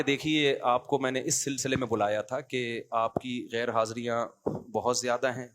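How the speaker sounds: calm, unhurried. Retold or imitated. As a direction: gently break bad news as if offering tea. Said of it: "بولتے بھی بڑے ٹھہراؤ کے ساتھ، آرام آرام سے". (نرم، ٹھہرا ہوا، آہستہ لہجہ)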